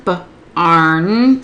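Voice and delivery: "B-arn" with a short "buh" split from a held "arn"; finger-spelled sounds. Shown as a demonstration. Teacher showing how to finger spell barn, segmented. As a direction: say "B-arn" in pieces, b first, then arn. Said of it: "have them finger spell barn".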